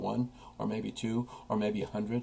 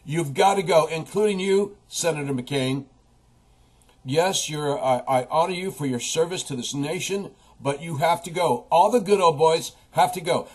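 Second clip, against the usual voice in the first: loud, higher-pitched political rant; emphatic demand; 5 semitones higher, 6 dB louder than usual. Real, not acted. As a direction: forcefully tell entrenched politicians to leave. Demanding that politicians leave office.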